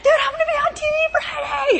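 high, loud excited squeal; bursting with news, squealing it out; excited shout. Retold or imitated. Telling her roommates, shrieking with excitement. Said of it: "I'm all fired up".

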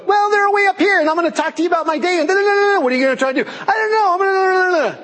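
very high, strained babble; way-up-here stressed voice. Acted. Acting out stressed people talking, high frantic squeal. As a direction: voice shooting way up, rattling on fast. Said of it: "how people talk. How's it going?".

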